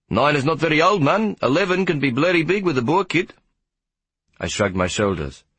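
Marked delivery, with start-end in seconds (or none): 0.1-3.3 high, animated character voice; chatty warder. Acted. Warder warns the boy about older opponents, animated. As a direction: play the warder sizing up a young boxer.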